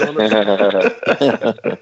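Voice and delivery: laughing through the punchline, slow and low. Real, not acted. Host teasing his co-host while laughing. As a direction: let the laugh break into the words. Host joking about Bay City Rollers.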